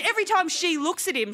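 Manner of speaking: usual speaking voice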